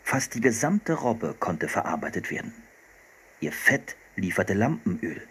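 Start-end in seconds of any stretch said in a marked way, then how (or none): none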